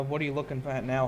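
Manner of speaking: normal talking voice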